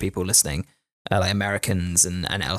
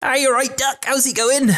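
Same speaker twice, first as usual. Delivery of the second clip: high-pitched mock Norfolk accent; Norfolk country accent. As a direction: put on a high rural Norfolk greeting. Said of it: "And then I moved to Norfolk".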